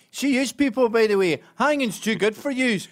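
loud, high broad Glasgow accent; strong Glaswegian accent. Shown as a demonstration. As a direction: growl it like a Glasgow hard man. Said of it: "This is a Glasgow accent".

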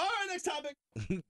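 high mock-handler voice shutting LeBron down; imitating Maverick Carter. Mimicking a handler steering his star away, high and slow. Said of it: "Maverick Carter's like".

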